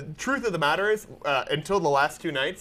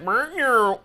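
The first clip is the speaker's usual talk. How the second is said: a light mock meow; cat meow; 5 semitones higher than usual. Friend voicing a coconut as a cat, light mock meow. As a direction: purr out a cute meow. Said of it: "it's like".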